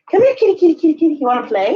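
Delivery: high, loud coaxing call; calling the cat. Real, not acted. Owner coaxing her cat out of the closet, high and loud. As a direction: lure him out with a bright high call.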